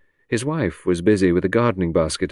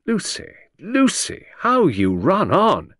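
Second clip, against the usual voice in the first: higher, slow teasing husband; slow fond chiding; 11 semitones higher, slower than usual. Acted. Husband chiding his rambling wife, slow and fond. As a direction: draw it out, amused and exasperated.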